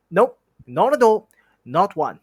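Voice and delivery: high, loud panicked take-back; hasty denial. Acted. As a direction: scramble to cover the slip. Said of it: "immediately afterwards".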